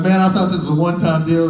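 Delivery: high, loud, fast protest; whiny complaint. Retold or imitated. Comic as new gang member protesting another beating, loud. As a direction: complain you got jumped again.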